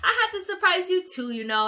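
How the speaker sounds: amused mom owning the prank; laughing mom. Retold or imitated. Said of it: "She was laughing. She was like".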